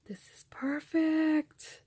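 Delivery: hushed, breathy whisper of delight; awed whisper. Real, not acted. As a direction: whisper it like a treasure found. Gasps then whispers over gift.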